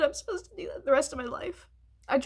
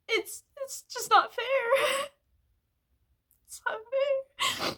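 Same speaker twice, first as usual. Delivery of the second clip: slow, higher tearful words; crying; 8 semitones higher, slower than usual. Real, not acted. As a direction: let the sob drag the words out. Crying, fairness.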